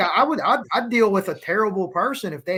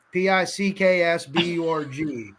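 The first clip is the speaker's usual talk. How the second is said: ordinary talk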